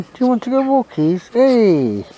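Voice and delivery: very high, loud singsong to the dog; excited high pet talk; high dog-calling voice. Real, not acted. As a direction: squeal it up to grab the dog's attention. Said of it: "That's one way of getting his attention".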